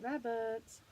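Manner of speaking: plain reading voice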